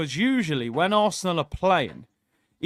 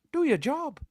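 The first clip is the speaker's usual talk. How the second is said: put-on high-pitched, quieter Irish accent; Roy Keane; 9 semitones higher, 7 dB quieter than usual. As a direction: do a lilting Irish accent impression. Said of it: "best Irish accent".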